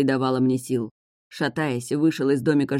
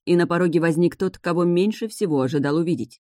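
plain reading voice

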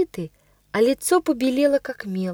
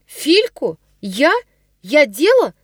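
high, louder frightened outburst; agitated cry; 4 semitones higher, 4 dB louder than usual. A narrator's reading. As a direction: cry out pale and shaken. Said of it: "вскричала она вся бледная и взволнованная". (взволнованный вскрик)

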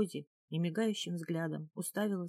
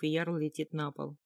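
ordinary delivery, nothing marked

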